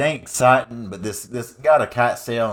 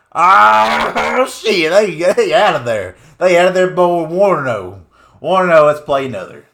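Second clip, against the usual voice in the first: higher and much louder, whooping; victory hollering; 6 semitones higher, 8 dB louder than usual. Acted. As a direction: crow over the win. Celebrating winning the first game.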